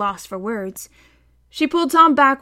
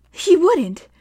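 higher, louder insisting; insistent cry; 8 semitones higher, 5 dB louder than usual. A narrator's reading. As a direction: insist against the truth. Hester insisting.

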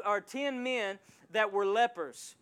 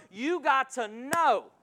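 slow, louder, climbing preacher push; slow pounding sermon build; fiery preaching; 3 semitones higher, 10 dB louder, slower than usual. Real, not acted. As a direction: drive it home to the congregation. Preaching at full force.

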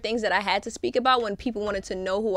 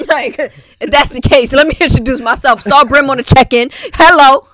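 loud intro; 4 semitones higher, 15 dB louder than usual. Real, not acted. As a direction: announce yourself loudly. Guest loudly introduces herself.

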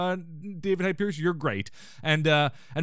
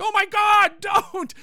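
much higher, louder comic outcry; alarmed mock shout; 7 dB louder, slower than usual. Host imitating Martin as Frasier drives off, alarmed mock shout. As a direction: yell in exaggerated horror, high and drawn out. Retold passenger protesting the driving.

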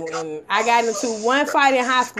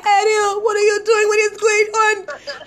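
high, drawn-out imitation of a panicked teacher; scared teacher; 7 semitones higher, slower than usual. Student retelling the teacher seeing the slap, scared teacher imitation. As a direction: mimic his alarm, pitched up, slowing at the end. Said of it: "he was scared".

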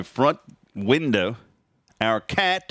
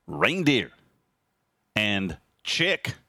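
ordinary talk, nothing marked

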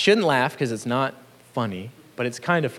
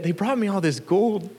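sheepish, much higher, innocent-sounding voice; Aaron's lame excuse; 6 semitones higher than usual. Retold or imitated. Aaron explains away the golden calf to Moses in a higher, sheepish, innocent voice. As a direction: play dumb like a caught child.

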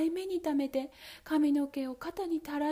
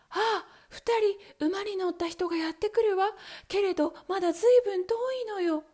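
higher, quick sister's voice; sister reporting; 9 semitones higher, 6 dB louder than usual. Acted. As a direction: report eagerly from the tower.

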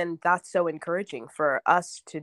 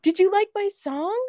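put-on child's voice; 14 semitones higher, 3 dB louder than usual. Toddler quoted by mother.

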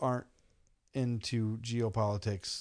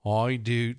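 attempted Scottish accent; playful stab at Scottish; 5 dB louder than usual. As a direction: have a go at a Scottish "I do". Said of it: "can you do it in a Scottish accent".

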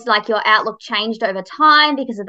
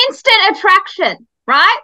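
excited; 8 semitones higher, 4 dB louder than usual. Real, not acted. Gushing about first meeting.

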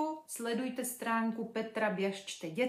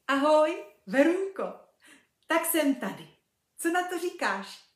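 high, loud, bubbly little creature; cheerful piglet voice; 6 semitones higher, 9 dB louder than usual. Acted. Little pink piglet greeting Verča, high and cheerful. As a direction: chirp it like a happy piglet.

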